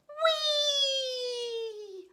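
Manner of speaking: long high loud whee; gleeful whee. Acted. Goose spiralling down, long high whee. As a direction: ride the swoop with joy. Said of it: "cried Gooseberry".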